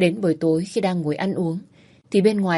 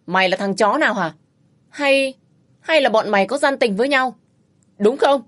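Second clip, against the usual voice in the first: higher, louder accusing yell; husband accusing the stranger of an affair; jealous shouting; 4 semitones higher, 3 dB louder than usual. Acted. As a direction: hurl the accusation.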